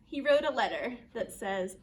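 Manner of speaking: plain reading voice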